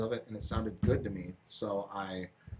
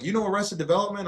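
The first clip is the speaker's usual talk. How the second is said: much higher, louder mock coworker asking; 11 semitones higher, 5 dB louder than usual. Coworker quizzing him about old songs, high mock voice. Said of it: "They're like".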